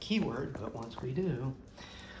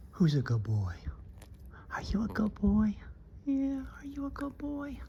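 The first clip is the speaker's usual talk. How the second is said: gentle whispered praise, rising higher; talking to a dog; 6 semitones higher than usual. Real, not acted.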